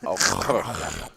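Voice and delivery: "Augurk" with a harsh throaty rasp, like coughing up a fur ball; "Augurk" forced out from the back of the throat, almost retching; gagging. Real, not acted. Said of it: "it just sounds like I'm coughing up a fur ball".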